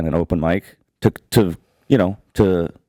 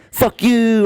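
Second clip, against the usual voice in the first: very high, drawn-out parting curse; mock angry yell; 13 semitones higher, 3 dB louder than usual. Jogger leaving into the darkness, mock angry yell. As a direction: imitate a furious parting shot. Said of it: "more and more angry".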